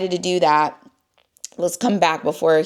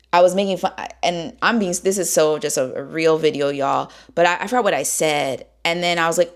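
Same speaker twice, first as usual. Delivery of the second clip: ordinary talk, nothing marked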